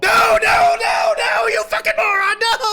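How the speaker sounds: high, very loud frantic scream; frantic yell. Real, not acted. Streamer screaming at the character's mistake, frantic. Yelling at the screen.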